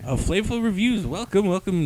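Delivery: podcast host greeting his listeners; show intro. Acted. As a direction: open the show like an announcer.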